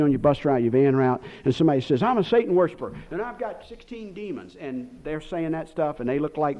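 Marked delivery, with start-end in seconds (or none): 2.0-4.6 higher-pitched mock confession, dropping soft; put-on high voice trailing into softness; imitated Satan worshiper. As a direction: lightly mimic someone confiding, voice raised in pitch then hushed. Mimicking someone met on visits.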